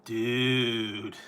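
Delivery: drawn-out surfer "Dude"; lazy California "Dude". Shown as a demonstration. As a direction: stretch "Dude" like a laid-back surfer. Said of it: "say, 'Dude.'".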